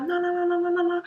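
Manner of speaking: high rapid string of nons; fluent-sounding rebuttal. Retold or imitated. To a colleague, a high fluent-sounding string of nos. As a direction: rattle off the nos like a native. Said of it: "quand l'anglais il est fluide".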